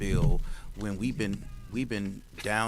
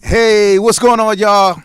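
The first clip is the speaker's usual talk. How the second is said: much higher and louder, hyped hello; excited greeting; 10 semitones higher, 16 dB louder, slower than usual. Real, not acted. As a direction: greet the crowd full of energy. Loud greeting opening the show.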